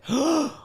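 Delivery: sharp, high intake of breath; shocked gasp. Acted. Reader reacting to a creepy threat with a shocked gasp.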